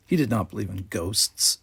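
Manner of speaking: ordinary delivery, nothing marked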